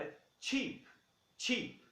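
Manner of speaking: cheap said with a ch sound and a long ee; cheap demo. Shown as a demonstration. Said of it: "Qual que é a pronúncia correta dela?".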